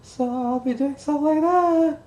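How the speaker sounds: high cartoon mouse, slow and squeaky; Mickey Mouse voice. Shown as a demonstration. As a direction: falsetto Mickey Mouse impression. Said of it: "my voice is breaking".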